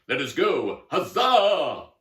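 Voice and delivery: loud, high rallying shout; heroic battle cry. Acted. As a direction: cry the charge and stretch the huzzah. Knight leads the charge.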